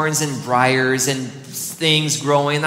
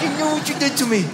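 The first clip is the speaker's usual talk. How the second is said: quick, high, accusing scold; a pointed mock scolding, fired off fast; scolding the dog; 10 semitones higher, faster than usual. Pastor scolding his muddy runaway dog, accusing. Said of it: "I'm like".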